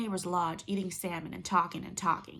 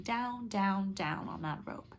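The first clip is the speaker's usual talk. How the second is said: slow going down; slower than usual. A narrator's reading. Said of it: "descending slowly".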